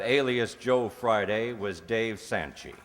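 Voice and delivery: ordinary talk, nothing marked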